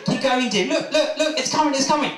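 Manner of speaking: high, eager urging; excited birth partner. Acted. Husband urging his labouring wife on, high and eager. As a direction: cheer her through the last push.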